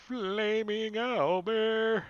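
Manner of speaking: high, slow, booming proclamation; dramatic announcement. Acted. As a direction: draw it out like an epic reveal. Dramatic announcement of burning owlbear.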